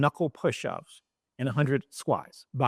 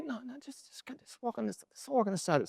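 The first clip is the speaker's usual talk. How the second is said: higher, hushed shooing; urgent hush; 9 semitones higher, 8 dB quieter than usual. Retold or imitated. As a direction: wave them off quietly. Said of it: "hushing the crew".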